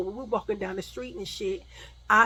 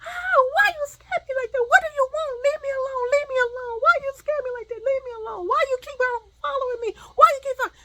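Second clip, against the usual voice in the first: very high, loud panicked shrieking; screaming in fright; 6 dB louder than usual. Retold or imitated. Woman screams at the man who scared her, panicked shrieks. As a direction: shriek the questions and pleas in terror, re-enacted. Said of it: "start screaming".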